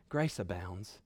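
very soft, low preacher's aside; hushed assurance. Real, not acted. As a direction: drop right down and say it gently. Hushed assurance over stumbling sinners.

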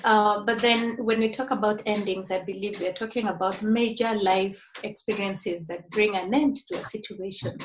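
ordinary talk

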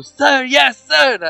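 high shouted sir yes sir; imitating a squad shouting back; recruits shouting. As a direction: bark it like a recruit in line. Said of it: "E aí todo mundo".

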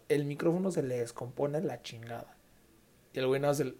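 normal talking voice

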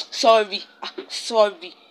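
impatient offhand apology; dismissive sorry. Shown as a demonstration. Showing how her apology sounds rude to friends, a dismissive sorry. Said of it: "you understand".

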